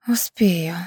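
squeezed out in a hoarse whisper. Acted. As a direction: force the word out breathlessly. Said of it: "сипло выдавила Лера".

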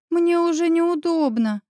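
high, slow apologetic reply; guilty friend owning up. A narrator's reading. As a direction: answer sheepishly, drawing it out. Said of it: "виновато отозвалась подруга".

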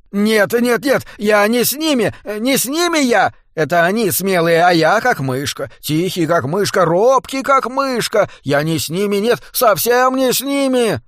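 high, strained, pleading wail; squeezed, panicky lament; choked wailing voice. Acted. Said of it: "сдавленным голосом запричитал Беззубый".

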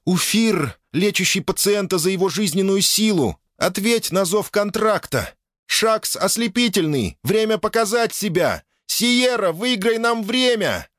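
high, forceful summoning call; commanding summons. Acted. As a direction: call the demons forth with authority. Princess summons her demons. (повелительный призыв)

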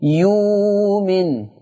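يومن very slow, long ū without hamza; ibdal demonstration. Shown as a demonstration. Teacher demonstrating يومن for students. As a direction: draw out يومن with a plain long wāw. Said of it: "فتقرأ يومن".

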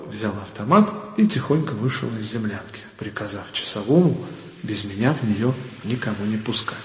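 ordinary talk

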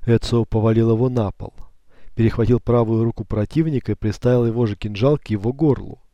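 plain reading voice

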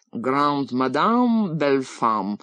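nasal, slow mock French; nasal twang. A narrator's reading. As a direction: drawl it through the nose. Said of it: "прогундосил Ростислав Михайлович".

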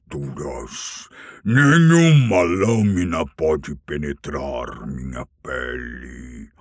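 a dragon boasting of its armor; haughty dragon. Acted. (dragão altivo e ameaçador)

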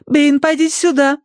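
high, imperious command; haughty order. A narrator's reading. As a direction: order the butler about. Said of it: "приказала она Бэйну".

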